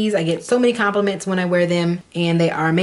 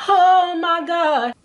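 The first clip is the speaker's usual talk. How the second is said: overwhelmed; 10 semitones higher, much slower than usual. Real, not acted. Tasting the ice cream.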